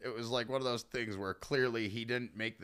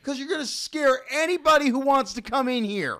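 high, loud retold scolding; exasperated retort; 12 semitones higher, 11 dB louder than usual. Store worker to man in a tarp, exasperated yelling. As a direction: yell the reason as if fed up.

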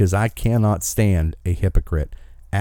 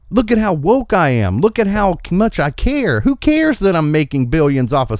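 mocking voice; 8 semitones higher than usual. Mocking a woke hypocrite's voice.

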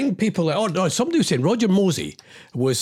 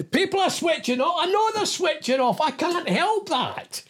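exasperated; 10 semitones higher than usual. Real, not acted. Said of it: "I'm just angry".

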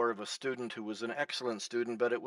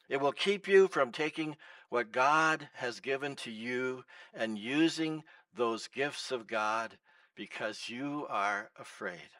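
ordinary delivery

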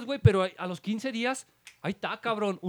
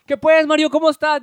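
uncle's hearty greeting; 7 semitones higher, 9 dB louder than usual. Retold or imitated. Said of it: "y todo voltea".

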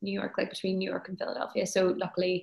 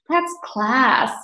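Northern Irish phrase in a mild mock American accent; lightly American-accented "That's class"; 5 semitones higher, 8 dB louder than usual. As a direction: say it like an American trying out Derry slang. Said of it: "Northern Irish things in American accent".

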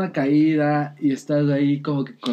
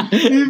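sound effect; 9 semitones higher, 7 dB louder than usual. Retold or imitated.

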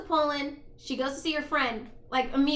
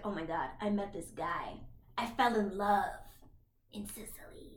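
dreamy hush; 6 semitones lower, 8 dB quieter than usual. Retold or imitated. Friend gushing in retold plot.

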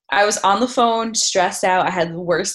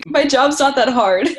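laughing mock excuse; 5 semitones higher, 5 dB louder than usual.

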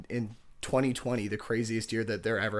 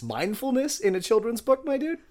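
surprised; 10 semitones higher, 4 dB louder than usual. Real, not acted. Amused reaction to the book page.

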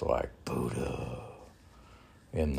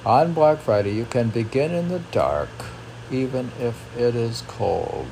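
much higher and louder, bursting with enthusiasm; excited outburst; 6 semitones higher, 7 dB louder than usual. Acted. Reading her text aloud, suddenly thrilled. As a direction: cheer it out like great news.

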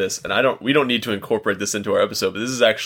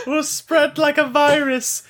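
high villain voice. Acted. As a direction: cackle out world domination. S1 joins the villain voice.

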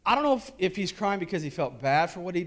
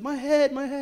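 put-on hurt little girl; 11 semitones higher than usual. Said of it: "She said".

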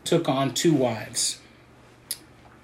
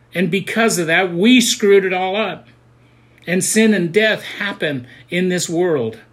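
louder, rising sermon cry; preaching at full force; 6 semitones higher, 6 dB louder than usual. Real, not acted. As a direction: drive it home to the congregation. Preaching climax.

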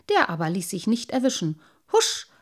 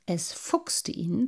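ordinary delivery

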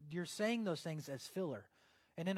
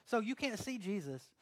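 ordinary talk

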